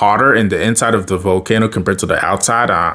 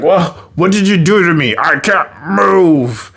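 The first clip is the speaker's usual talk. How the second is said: stuck villain whine; 6 semitones higher than usual. Retold or imitated. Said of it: "Then he's like".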